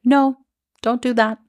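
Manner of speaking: mild imitated inner voice saying no; inner warning voice. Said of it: "my splenic authority being like".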